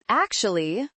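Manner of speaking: ordinary delivery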